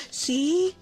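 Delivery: high, loud "told you so" call; mock triumphant exclamation. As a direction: crow it high and loud, playfully vindicated.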